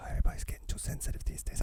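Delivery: very soft, quick whispered complaint; hushed, hurried put-on whisper; mock whisper. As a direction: whisper it fast, as if it mustn't be overheard. Mock-secretive complaint after a gasp.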